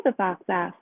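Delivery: ordinary delivery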